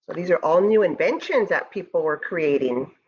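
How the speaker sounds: usual speaking voice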